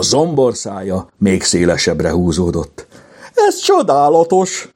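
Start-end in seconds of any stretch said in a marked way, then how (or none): 3.3-4.7 high, delighted cry; thrilled exclamation; exclaiming in wonder. A narrator's reading. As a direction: call it out amazed, pitch way up. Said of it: "kiáltotta".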